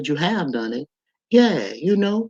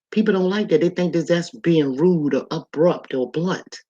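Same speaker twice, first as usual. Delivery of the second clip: ordinary delivery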